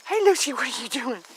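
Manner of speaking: loud sing-song pet call; loud pet-talk call. Real, not acted. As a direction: call out playfully to the cat. Calling to pet.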